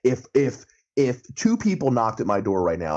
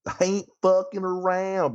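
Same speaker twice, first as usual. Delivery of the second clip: slow, much higher Ozark drawl; Ruth's Ozark accent; 9 semitones higher, slower than usual. Retold or imitated. Repeating the Ruth line for his friend in a slow Ozark accent. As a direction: stretch it out in a raised Ozark twang. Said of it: "Ruth's got a tough accent".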